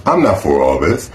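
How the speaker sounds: ordinary delivery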